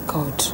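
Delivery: breathy sigh of dismay; weary sigh. Acted. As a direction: let out a pained sigh. Mother sighs at daughter's story.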